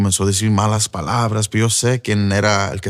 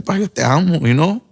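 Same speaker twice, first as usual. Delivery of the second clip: retold plea to his father, higher and close to tears; a son begging through tears, pitched up; tearful plea; 5 semitones higher than usual. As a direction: son begs his father not to die to drugs, choked up. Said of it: "Yo lo miraba con lágrimas también".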